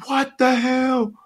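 drawn-out high What the hell; slow high shocked crew. Retold or imitated. The boat captain seeing the bloody pair, slow and high in shock. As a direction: stretch it out in disbelief.

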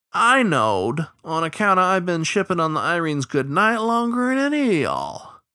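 high, folksy know-it-all voice; boastful old riverman. Acted. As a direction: brag in a high, swooping riverman's voice.

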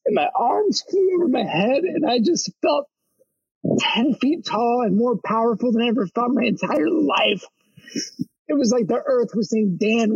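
retelling a breakthrough in yoga, voice lifted high; awestruck. Retold or imitated. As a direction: relive the jolt of power with wonder. Recalling awakening moment in yoga.

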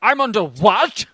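high, loud Australian outrage; indignant Aussie. Retold or imitated. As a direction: act shocked and offended. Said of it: "that guy getting arrested".

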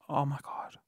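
hushed, low-key swoon; retold awed reaction. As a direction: say it very softly, overcome. Retold reaction to being stroked.

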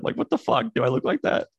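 high, airy disbelief; incredulous. Real, not acted. Reacting to angry cartoons.

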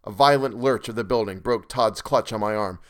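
usual speaking voice

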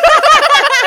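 loud, high-pitched laugh; big helpless laugh before going on; burst of laughter. Real, not acted. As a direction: burst out laughing mid-sentence. Bursts out laughing mid story.

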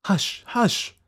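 high, urgent shushing; alarmed exclamation. A narrator's reading. Doctor silencing Fettes, alarmed exclamation. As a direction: hush him urgently at a high pitch. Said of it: "exclaimed the doctor".

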